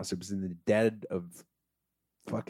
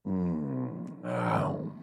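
low drawn-out "mm, oh" of relief; slow, low savoring moan; contented sigh; 10 semitones lower, 4 dB quieter than usual. Acted. Storyteller miming relief at his glass of water, contented sigh.

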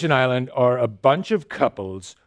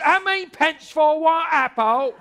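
much higher, hammed-up British accent; squeaky mock Brit haggling over pence; 12 semitones higher than usual. Hammed-up British accent asking about apple prices. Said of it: "if you spoke with a British accent".

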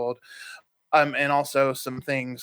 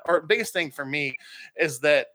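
ordinary talk

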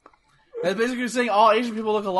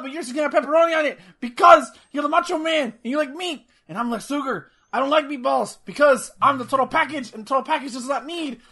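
wrestling promo; 6 semitones higher than usual. Pretend. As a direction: cut a wrestling promo in a higher voice. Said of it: "I give this promo".

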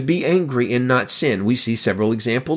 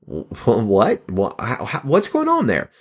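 baffled; slower than usual. Acted. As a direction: act out puzzled disbelief, stumbling into the question. Voicing a baffled reaction to the verse.

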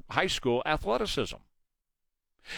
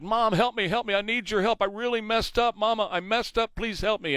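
higher, pleading mock cry for help; imitating a panicked teen daughter; 6 semitones higher than usual. Fake daughter begging her mom on the phone, high pleading. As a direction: lightly act out a scared girl begging her mom. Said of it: "the voice of her daughter said".

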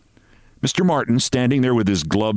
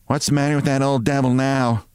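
put-on character voice, higher; lightly voiced colleague, raised pitch; office colleague; 4 semitones higher than usual. A narrator's reading. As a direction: ask as a nosy co-worker. Office colleague asks about Barrows.

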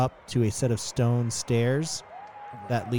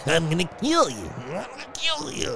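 raised pitch climbing into a high squeal; crazed fighter's threat; 6 semitones higher than usual. Acted. Filthy fighter to his opponent before charging, crazed high voice. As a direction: threaten wildly, going higher on the repeat. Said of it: "looks at you and goes".

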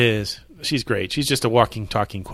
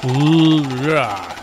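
excited cheer; 3 semitones higher, 5 dB louder than usual. Real, not acted. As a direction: cheer the spinning wheel. Reacting to the wheel spin.

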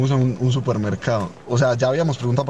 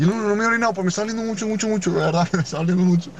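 imitating a high whiny complaint, much higher; mock pained whine; 8 semitones higher, faster than usual. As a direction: whine it like a desperate friend. Friend insists he's in pain.

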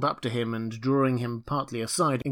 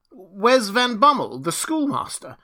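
put-on bewildered old Rip; 9 semitones higher, 7 dB louder than usual. A narrator's reading. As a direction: call out anxiously for the schoolmaster. Rip asks again, increasingly distressed.

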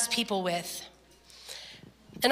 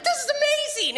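high, thrilled "amazing"; excited amazement. Retold or imitated. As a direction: gush with delight. Retold amazement, echoed by [name].

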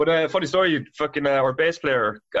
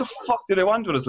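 high, fast mock panic; panicked retold question; 8 semitones higher, faster than usual. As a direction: blurt it out fast and high in alarm. Bandmates panicking, retelling.

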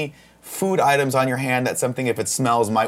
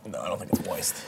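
whisper; 10 semitones lower, 12 dB quieter than usual. Real, not acted. Host teasing the blindfolded guest.

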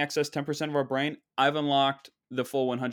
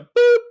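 high, loud vocal bleep; spoken bleep over a curse; censor beep; 14 dB louder than usual. Acted. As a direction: beep out the swear word.

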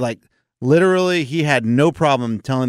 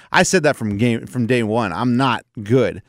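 usual speaking voice

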